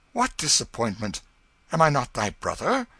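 loud, high, startled cry; cried out. A narrator's reading. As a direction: call out in disbelief. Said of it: "cried out".

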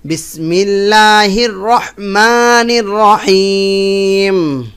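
slow, melodic chanted recitation; tajweed-style chant; Quranic recitation. Shown as a demonstration. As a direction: recite slowly with a drawn-out tune. Recites after explanation.

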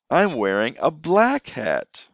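put-on teacher wearing a hat for the child, slow and clear. Shown as a demonstration. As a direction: say it slowly while putting it on. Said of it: "put it on my head".

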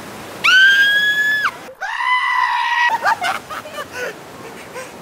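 high shrieking triumphant laugh. Real, not acted. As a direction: cackle loud and high at the loser. Friend lost rock paper scissors.

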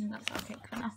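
hushed whisper aside. Real, not acted. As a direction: whisper it under your breath.

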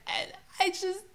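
ordinary delivery

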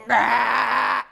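loud harsh caw; crow's call. Retold or imitated. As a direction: caw like a big old crow.

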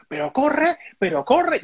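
high urgent plea to hurry; pleading with the driver to run; retold panic. Urging driver to flee trailer.

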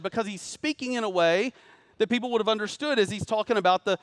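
animated preaching. Acted. Preacher explaining Paul's armor images, animated. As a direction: fire up the room about the armor.

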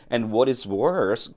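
slow, careful model sentence; slow model reading. Shown as a demonstration. Teacher modelling English slowly for students, slow and careful. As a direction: read it out slowly for learners to copy.

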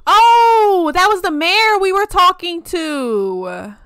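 loud, high, drawn-out "oh"; surprised realization. Real, not acted. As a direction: exclaim it as it suddenly dawns on you. Sudden realization about a game character.

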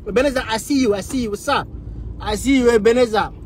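ordinary delivery

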